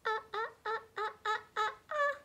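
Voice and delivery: high little "ah" sounds; high playful "ah" while tracing; high short sounds. Shown as a demonstration. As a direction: squeak "ah, ah, ah" for the little U. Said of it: "tracing little U".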